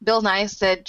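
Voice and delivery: normal talking voice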